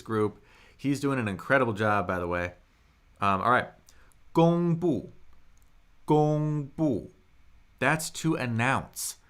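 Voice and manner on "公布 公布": 公布 with high level 公, sharply falling 布; teacher modelling first and fourth tones in 公布; tone demonstration. Shown as a demonstration. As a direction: hold 公 high and flat, then drop 布. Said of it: "That's first tone, fourth tone".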